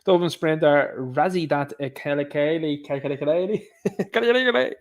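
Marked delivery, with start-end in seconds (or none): 2.9-4.8 breaking into laughter mid-name, drawn out; laughing through the name. Real, not acted. Host tripping over a sprinter's name, laughing. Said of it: "Can't even get through it".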